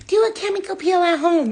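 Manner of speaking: high, loud mocking voice; mock advice voice. Mocking the YouTube woman's advice. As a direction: parrot the online lady snootily.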